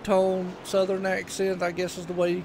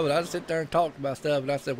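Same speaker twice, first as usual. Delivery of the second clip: ordinary talk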